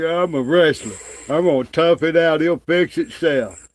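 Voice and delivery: hammed-up mimic of a cocky wrestler; mock tough-guy wrestler. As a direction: parrot his bragging to ridicule him. Mocking rival's tough talk.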